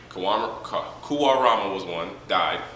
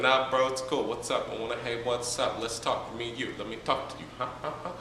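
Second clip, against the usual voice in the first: casual bro voice; 3 semitones higher, 5 dB quieter than usual. Retold or imitated. Madara greeting grieving Hashirama, casual bro voice. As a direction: greet him like a chill homie. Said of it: "He's like".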